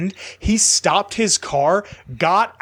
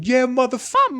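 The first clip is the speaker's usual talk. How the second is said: retold yelling; 8 semitones higher than usual. Driver going off at the man screaming at them, retold yelling. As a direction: re-enact an instant road-rage comeback. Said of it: "I just start yelling at him".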